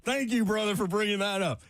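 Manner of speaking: high, excited radio host; thrilled. Real, not acted. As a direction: seize on the caller's idea with rising delight. Thrilled by caller's draft question.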